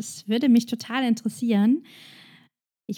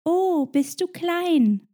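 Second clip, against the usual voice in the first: high, slow cooing "Oh, bist du klein?"; mocking a stranger who pats the head; patronising coo; 8 semitones higher, much slower than usual. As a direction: talk down to a child, drawn out. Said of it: "es sagt".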